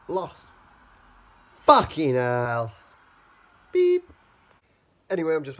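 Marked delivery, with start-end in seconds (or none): 1.6-2.7 slow, loud frustrated swear; real frustration at the loss; frustrated curse. Real, not acted. Team just lost. 3.7-4.1 high, loud bleep sound; mock censor beep. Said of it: "self-censor".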